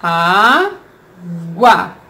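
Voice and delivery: "Água" drawn out as á... gua; slow, split syllables. Shown as a demonstration. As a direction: pause between the two syllables of "Água". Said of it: "Fala devagar aí a palavra".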